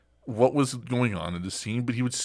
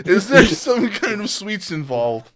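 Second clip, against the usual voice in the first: laughing; 9 semitones higher, 7 dB louder, slower than usual. Real, not acted. Laughing through joke.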